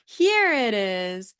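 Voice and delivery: very slow "Here it is" with a long "Here"; slow parentese model. Shown as a demonstration. Therapist pointing out an object to a child, slow and stretched. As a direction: stretch the vowels as you point. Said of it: "pointing to something and saying".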